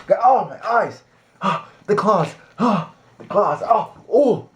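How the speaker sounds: high, hammed-up whimpering; playful wounded squeal; mock hurt. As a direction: pretend to be wounded. Pet scratches her.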